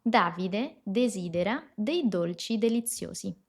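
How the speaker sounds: "Davide desidera dei dolci deliziosi" slowly, every D with the tongue behind the upper teeth; slow Italian model sentence. Shown as a demonstration. Teacher reading the practice sentence slowly for students, slow careful model. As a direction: read it out slowly like a pronunciation model. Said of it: "Vamos a practicar algunas frases juntos".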